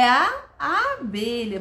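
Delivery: "abelha" with a long opening A; stretched first sound. Shown as a demonstration. Teacher answering for children, "abelha" with held A. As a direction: hold the A, then finish "abelha". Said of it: "Viu que o início eu foquei no som?".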